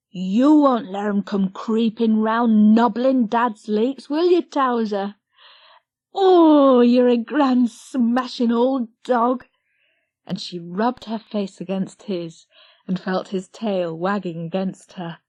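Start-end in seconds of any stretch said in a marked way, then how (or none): 0.1-9.5 high, loud, fond chatter to a dog; excited. Acted. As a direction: gush over the dog lovingly. Lizzie cooing to the dog.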